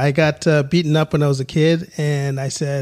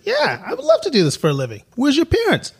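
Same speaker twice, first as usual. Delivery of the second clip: raised, quick and keen; eager retold exchange; 11 semitones higher than usual. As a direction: act out an eager chat about a big break. Retells child and man's exchange.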